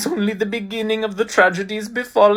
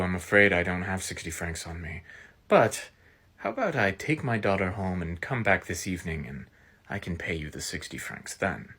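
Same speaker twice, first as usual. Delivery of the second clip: ordinary delivery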